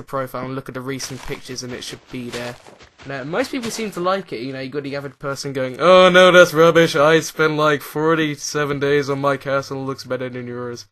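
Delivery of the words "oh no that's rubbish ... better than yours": nerd impression. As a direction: burst out loudly as a boastful nerd, easing off toward the end. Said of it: "don't know if it's a nerd impression".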